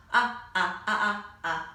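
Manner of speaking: up repeated short; teacher models the short U with up; short U sound. Shown as a demonstration. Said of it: "like up".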